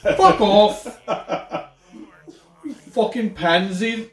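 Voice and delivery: put-on British accent. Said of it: "is that a British accent".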